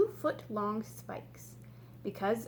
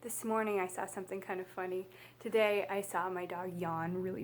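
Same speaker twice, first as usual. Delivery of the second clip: ordinary delivery, nothing marked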